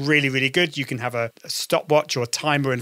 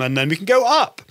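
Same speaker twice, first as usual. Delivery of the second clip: pitch going up; 5 semitones higher than usual. Shown as a demonstration. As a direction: swoop upwards to the word "up". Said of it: "we change the pitch".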